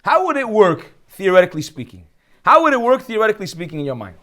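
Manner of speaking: usual speaking voice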